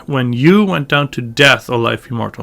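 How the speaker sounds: spoken line, "you" and "death" emphasized; stress on you and death. Shown as a demonstration. Teacher confirming the emphasis, stressing "you" and "death". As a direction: hit "you" and "death", drop away after. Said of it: "and emphasis here".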